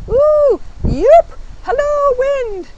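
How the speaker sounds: high, loud joyful whooping; excited whoops. Real, not acted. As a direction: call out happily to the wind. Joyful calls out in the wind.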